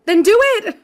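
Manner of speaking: high, loud yell; shrieked retort from labor pain. Acted. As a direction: yell it back in pained desperation. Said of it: "I yelled back".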